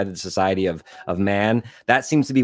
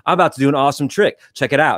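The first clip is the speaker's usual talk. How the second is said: put-on quick, higher, louder skater-kid brag; Kyle Mooney skater cadence; 5 semitones higher, 4 dB louder, faster than usual. Skater kid hyping his trick on camcorder, fast, pitched up. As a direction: rattle it off like a proud skater kid. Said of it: "that cadence".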